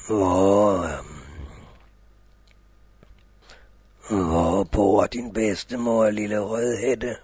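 deep booming big bad wolf. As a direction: growl it out low like a big wolf. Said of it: "the wolf questions Rødhætte".

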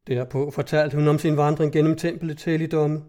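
ordinary delivery

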